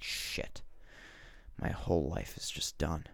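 whispered, dazed mutter; stunned whisper. Retold or imitated. Morocco hearing the diagnosis, stunned whisper. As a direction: breathe it out in shock.

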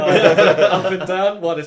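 ordinary delivery

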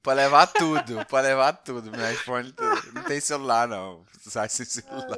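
loud pleading, hammed-up panic; mock panicked victim. Tourist pleading with a robber in an alley, loud mock panic. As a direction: beg the robber to take everything, half joking.

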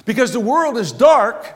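loud rising sermon delivery; full-force preaching. Acted. Pastor stirs the church about their identity, preaching loudly. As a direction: preach it out to the back pews.

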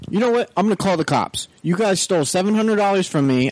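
high mock-furious customer voice; angry customer. Retelling the customer yelling on the phone, high mock fury. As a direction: ham up the irate caller.

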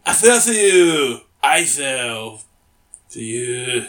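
drawn-out, badly faked French accent; attempted French accent. Host repeats the punchline, drawn-out fake French accent. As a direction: overdo a clumsy French accent. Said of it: "say it in a French accent".

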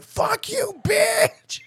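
loud high yell; hammed-up high yell; retold shout. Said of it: "The moment it hit green".

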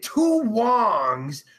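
very slow, deliberate reading of the punchline; slow quoted joke. Retold or imitated. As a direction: read the joke out word by word. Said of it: "he said".

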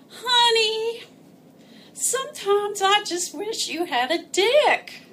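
high, slow whine; whiny voice. Retold or imitated. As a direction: whine it into her ear. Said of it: "nuzzled up to my ear and whined".

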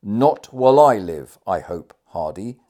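loud, slow, indignant refusal; forceful cry from a dying chief. A narrator's reading. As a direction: burst out hard, then ease off on the name. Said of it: "cried the dying chief".

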